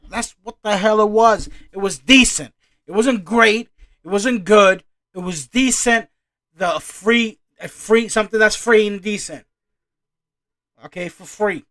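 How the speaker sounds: loud, high, emphatic ranting; worked-up, insistent shouting; heated rant. Real, not acted. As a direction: shout each point to drive it home. Heated rant about her fame.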